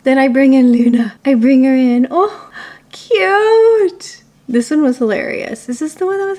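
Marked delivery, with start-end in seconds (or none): 2.1-4.2 cooing. Real, not acted. Cooing at dog photo.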